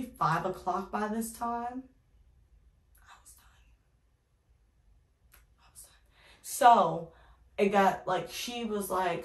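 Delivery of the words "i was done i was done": breathy whisper, spent and done. Real, not acted. Telling viewers she was exhausted, whispered. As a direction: let it fade to a whisper.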